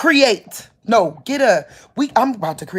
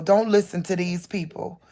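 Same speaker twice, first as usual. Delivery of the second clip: usual speaking voice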